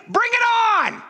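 high shouted challenge; defiant shout. Real, not acted. Pastor daring the world's opposition, defiant shout. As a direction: throw it out like a dare.

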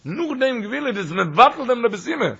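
fast, high, loud sermon drive; preaching. Acted. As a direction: press the teaching home with fire.

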